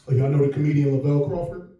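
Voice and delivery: ordinary talk, nothing marked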